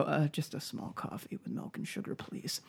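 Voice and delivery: hushed whisper, barely there; mock-timid whisper; whispered order. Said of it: "And I go".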